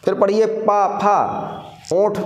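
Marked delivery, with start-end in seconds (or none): 0.4-1.2 पा, फा made by closing the lips; teacher's separated lip sounds पा, फा; labial sounds demonstrated. Shown as a demonstration. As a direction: press both lips together for पा, फा. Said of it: "जुड़ रहे हैं आपस में".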